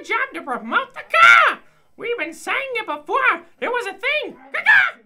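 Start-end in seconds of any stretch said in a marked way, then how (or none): 4.5-5.0 sharp high crow caw; bird call. Acted. Bird man squawking at the customer.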